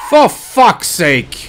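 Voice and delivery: loud, slow, high exasperated yell; real slow furious yell; furious shout. Real, not acted. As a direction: drag out the curse in fury. Frustrated at game death.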